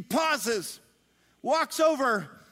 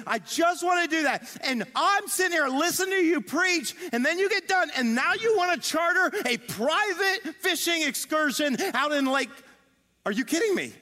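higher-pitched incredulous rant; exasperated; 8 semitones higher than usual. Acted. Tired Peter to Jesus after fishing all night, exasperated. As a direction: build a put-upon complaint in a raised pitch.

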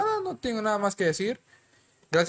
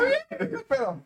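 sarcastic falsetto. Real, not acted. His food was shared away.

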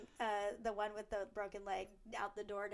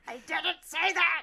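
loud, high mock outburst; hammed-up exasperated denial; indignant protest; 7 semitones higher, 11 dB louder than usual. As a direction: yell it slowly in playful indignation. Acts out Marcy's indignant protest.

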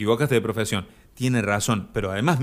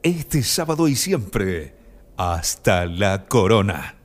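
slow announcer slogan voice; lightly voiced party announcer, drawn out; club promo slogan; 4 semitones lower, slower than usual. Host doing the club's ad slogan for Victoria, slow announcer style. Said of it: "el que dice".

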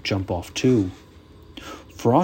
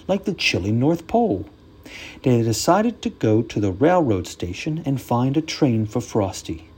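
ordinary delivery

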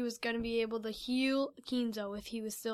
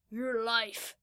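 sinister. Acted. Man reveals he is Akrillis.

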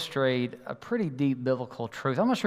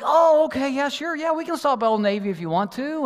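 realizing; 10 semitones higher, 4 dB louder than usual. Retold or imitated. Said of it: "I was like".

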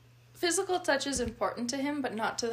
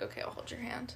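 whispered; 11 dB quieter than usual. Retold or imitated. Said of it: "he'll be like".

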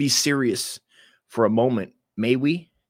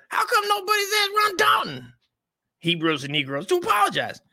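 very high, shouted put-on voice; mock outrage. Parodying the rival's rant in a high mock shout. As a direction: shriek it like a hammed-up rant.

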